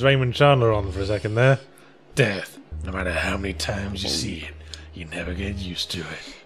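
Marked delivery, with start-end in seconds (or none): 2.2-6.4 hushed hardboiled detective voice; noir narrator whisper. Pretend. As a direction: breathe it like a film noir narrator.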